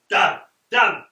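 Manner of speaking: loud frustrated curse; shouting wall. A narrator's reading. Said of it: "shouts the wall".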